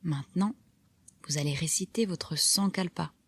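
usual speaking voice

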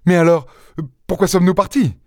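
high-pitched, agitated protest; alarmed question. A narrator's reading. Horrified doctor questioning Lupin, alarmed. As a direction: demand an answer in rising alarm.

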